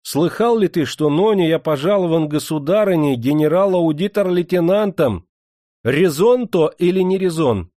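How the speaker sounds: high, loud, self-important; indignant boasting. A narrator's reading. Master telling steward of his new general's rank, indignant boasting. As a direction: proclaim the new title grandly.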